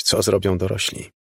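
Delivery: ordinary delivery, nothing marked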